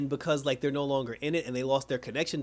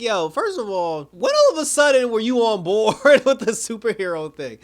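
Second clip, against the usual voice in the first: incredulous outburst; 7 semitones higher, 10 dB louder than usual. Retold or imitated. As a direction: call them out in an exaggerated, raised voice. Retold Cyborg challenges sudden change of heart.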